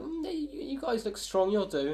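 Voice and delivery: high-pitched voice sizing them up; appraising giant. Acted. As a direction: size up new workers in a high put-on voice. Game master voices appraising giant.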